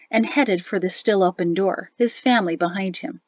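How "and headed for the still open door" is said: plain reading voice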